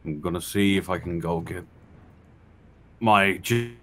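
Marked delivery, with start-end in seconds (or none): none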